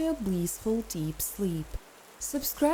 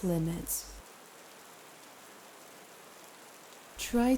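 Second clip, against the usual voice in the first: calm meditation guide with long pauses; guided meditation; 6 dB quieter than usual. Acted. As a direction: ease the listener into rest, phrase by phrase.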